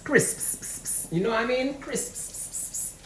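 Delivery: Crisps whispered with hissing long s. Shown as a demonstration. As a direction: Crisps breathed unvoiced, s drawn out. Whispered crunchy crisps sound.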